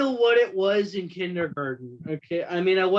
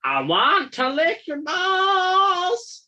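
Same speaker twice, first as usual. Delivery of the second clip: much higher, slow squeaky dog voice; pet voice; 5 dB louder, slower than usual. Pretend. Voicing the dog begging her for a grape, high and slow.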